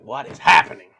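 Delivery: alarmed disbelief. Acted. Reacting to someone flying off the treadmill, mock alarm. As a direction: cry out in startled confusion.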